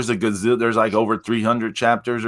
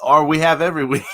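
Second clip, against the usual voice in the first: ordinary delivery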